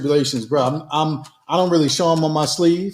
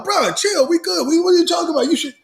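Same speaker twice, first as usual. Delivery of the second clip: mock exasperation; 11 semitones higher than usual. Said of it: "I'm thinking you should already know".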